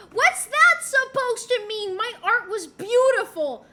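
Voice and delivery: loud, high, offended outburst; indignant protest. Real, not acted. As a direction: protest loudly as if insulted. Mock offence at remark on drawing.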